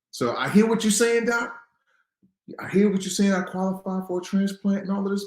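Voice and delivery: high, loud, fired-up challenge to the doc; fired-up defiance. Retold or imitated. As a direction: throw it back at the doctor with fight. Defiant words to doctor.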